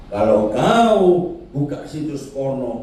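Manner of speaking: usual speaking voice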